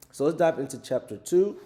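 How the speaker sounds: high and loud, calling it out; excited announcement. Real, not acted. Host launching chapter two to listeners, high and excited. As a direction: kick off the new chapter with a lift.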